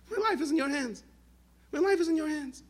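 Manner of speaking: a woman facing a gun, handing her life over; fervent surrender. Retold or imitated. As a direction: cry out your trust to God. Woman surrendering to God under gun.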